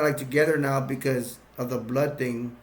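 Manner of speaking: usual speaking voice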